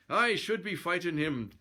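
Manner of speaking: hint of a stubborn Swedish brute; mock Swedish accent. Narrator voices insistent Swede.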